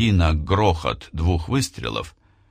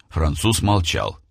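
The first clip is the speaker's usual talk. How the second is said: usual speaking voice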